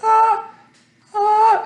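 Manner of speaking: mock a ref who can't decide whether to step in; high unsure referee noise; hesitant referee. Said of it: "The ref, like, half steps in".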